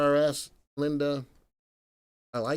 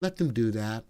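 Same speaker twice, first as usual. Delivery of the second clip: soft, lower imitation of wife; gently permissive, quietly waving it off; indulgent wife; 4 semitones lower, 6 dB quieter than usual. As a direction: play the easygoing grandma, hushed. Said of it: "And my wife is like".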